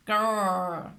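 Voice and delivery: playful dinosaur growl; happy growl. A narrator's reading. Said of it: "growled George happily".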